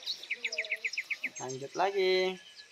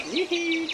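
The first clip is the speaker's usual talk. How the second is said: giggle; 10 semitones higher, 4 dB louder than usual. Real, not acted. Giggling at fast sprint.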